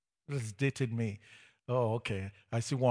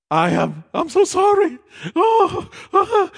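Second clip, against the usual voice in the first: mock anguished plea; 13 dB louder than usual. Denies pleading sorry to the Lord.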